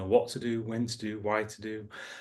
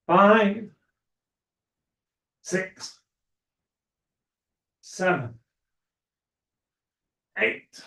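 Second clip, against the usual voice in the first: aerobics instructor; 6 semitones higher, 7 dB louder, much slower than usual. Acted. Instructor counting reps loudly.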